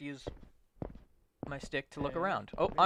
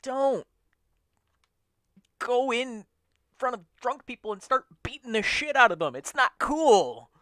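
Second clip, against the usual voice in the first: loud exasperated lecture; lecturing; 7 semitones higher, 7 dB louder than usual. Real, not acted. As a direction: scold him like a fed-up parent. Lecturing Kiryu emphatically.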